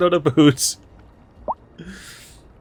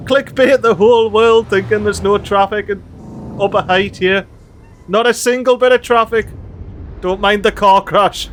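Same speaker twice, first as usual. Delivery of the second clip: louder, higher thrilled yelling; excited shouting; 6 semitones higher, 6 dB louder than usual. Real, not acted. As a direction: call out the rare sight. Excited at no traffic.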